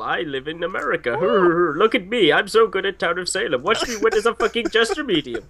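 singsong mock bragging; mocking imitation. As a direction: show off in a hammed-up imitation. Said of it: "now you're trying to imitate me".

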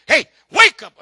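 sudden loud playful shout; mock wake-up shout. Pastor teasing a sleeper in the pews, playful loud shout. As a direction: jolt a dozing friend awake. Mock nudging a napping listener.

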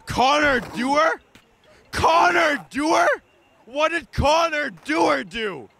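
can't believe this player; outraged fan shouting a player's name; incredulous shout. Acted. Fan ranting at trade choices.

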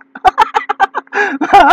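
high burst of laughter; a real laugh breaking out. Real, not acted. Laughing at own joke.

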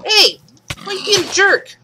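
high, loud indignant yell. Real, not acted. To a mole attacking her, high loud indignant yell. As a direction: shout at it for being a jerk.

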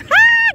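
very high, loud ha shout; sharp exultant yelp; preacher's whoop. Real, not acted. Preacher whooping in the pulpit, loud high yelp.

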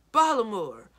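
loud shouts of Ballamore from another room; husband hunting for the inkwell, shouting for his wife; calling from down the hall. A narrator's reading. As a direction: bellow down the corridor for his wife. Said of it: "Doblin's voice echoed down the corridor".

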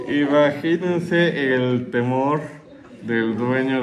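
speaking slowly through laughter; laughing. Real, not acted. Preacher still laughing at his own joke, slowly.

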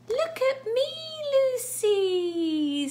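high, loud cat showing off; cat calling out. Acted. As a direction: call down proudly as a playful cat. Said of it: "the other cat's going".

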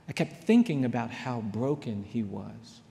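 ordinary talk

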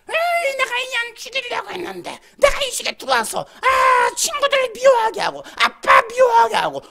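high, strained demon shriek; loud, malicious possessed voice; boasting demon. Acted. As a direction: screech out the evil deeds, stretching the words. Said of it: "그러면서 하는 소리가".

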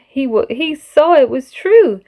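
plain reading voice

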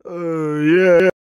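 ordinary talk, nothing marked

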